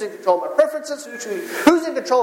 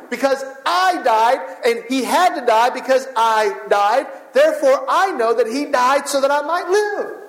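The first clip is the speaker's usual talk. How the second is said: rising preaching; 4 semitones higher than usual. Acted. Pastor preaching to the congregation, rising and fervent. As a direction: preach it out, climbing in pitch.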